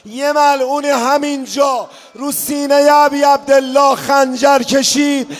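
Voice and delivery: loud rising lament, crying out the questions; impassioned mourning sermon; preaching at full force. Acted. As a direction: build to an anguished public outcry.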